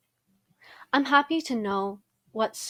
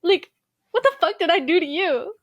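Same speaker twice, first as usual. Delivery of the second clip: higher, louder mock-offended outburst; mock outrage; 9 semitones higher, 9 dB louder than usual. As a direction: play up being wounded by a kid's insults. Outraged at brother's insults.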